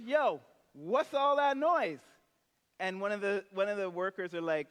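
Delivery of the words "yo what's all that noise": high, loud, slow yell of a grumpy brother; older brother demanding what the noise is, loud and high; annoyed shout. Retold or imitated. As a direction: call out over the party noise, irritated. Older brother calls out at party.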